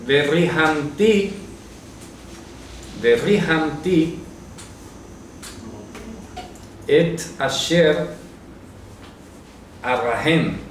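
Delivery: slow, syllable by syllable Hebrew reading of "Verrihamti, verrihamti et asher arrahem"; Hebrew recitation. Shown as a demonstration. Teacher to Bible study group, reads the Hebrew slowly and carefully. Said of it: "Mira lo que dice en hebreo".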